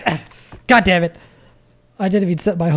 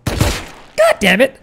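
frustrated outburst; 8 semitones higher, 7 dB louder, much slower than usual. Real, not acted. Trap fails, streamer frustrated.

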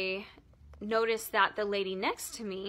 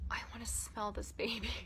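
softer, unvoiced whisper of a secret wish; whispered confession; 9 dB quieter than usual. Real, not acted. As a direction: breathe it out like a guilty secret. Confessing an embarrassing urge.

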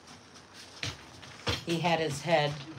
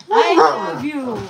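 high, slow cooing; 8 semitones higher, 10 dB louder, slower than usual. Real, not acted. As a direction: coo it slowly to a pet. Cooing at the dog.

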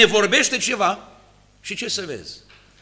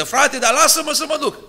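whiny insistence; 7 semitones higher than usual. Retold or imitated. Mocking an insistent church youth.

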